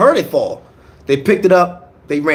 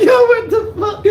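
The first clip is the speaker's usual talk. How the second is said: shocked disbelief. Retold or imitated. Disbelief after being told to leave.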